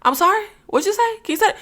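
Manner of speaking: high, soft, timid questions; small high-pitched voice for an acted-out apology; apologetic asking to repeat. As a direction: ask softly and high, politely sorry you missed it. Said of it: "I gotta be like".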